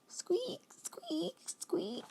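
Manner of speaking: high, soft squeaks falling each time; squeaky swing. A narrator's reading. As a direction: imitate a squeaky swing creaking.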